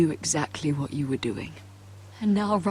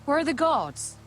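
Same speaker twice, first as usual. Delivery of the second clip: raised, questioning call; 9 semitones higher than usual. Acted. Looking for the missing guards, calling out. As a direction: call out higher, searching for an answer.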